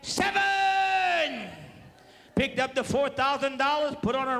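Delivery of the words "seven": yelling seven. Retold or imitated. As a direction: shout it out at the dice table. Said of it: "she yelled".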